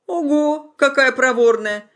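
high, slow mocking drawl; ironic drawl. A narrator's reading. Old man mocks the bride, slow ironic drawl. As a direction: sneer at the girl's boldness. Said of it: "иронически протянул старик".

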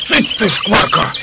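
hushing the dog. Acted.

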